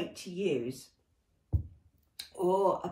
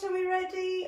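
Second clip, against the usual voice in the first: ordinary talk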